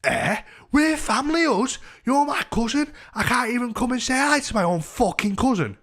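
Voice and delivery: very high, loud mock protest; indignant drunk woman. Drunk cousin protesting outside his door, indignant. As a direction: mimic an outraged relative, pitched right up.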